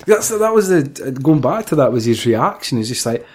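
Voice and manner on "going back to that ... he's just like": ordinary talk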